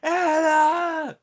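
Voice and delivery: drawn-out high, loud yell; John Silver calling out. Retold or imitated. Wrestler calling for his absent teammate, high drawn-out yell. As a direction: holler the name way up high, stretching it out. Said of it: "John Silver's like".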